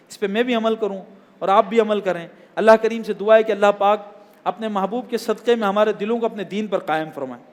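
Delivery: normal talking voice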